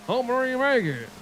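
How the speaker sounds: thick country mumble. Acted. Streamer mimics a Water Boy character, thick country mumble. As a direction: imitate a garbled backwoods movie character. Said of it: "like the dude in ... The Water Boy".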